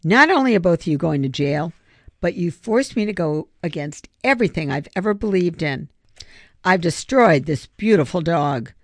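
ordinary delivery

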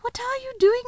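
very high, surprised; an alarmed girl exclaiming. A narrator's reading. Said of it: "exclaimed Susan".

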